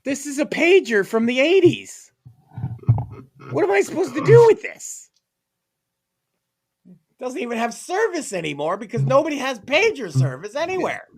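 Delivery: high, incredulous mock outburst; exasperated helper. Helper handed an eighties pager, exasperated protest. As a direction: protest in squeaky-high disbelief. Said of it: "you're just like".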